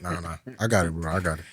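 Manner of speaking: low, unbothered brush-off; calm, stoic. Acted. Usher refusing to hand over his chairs, calm and stoic. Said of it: "Just very calm, stoic".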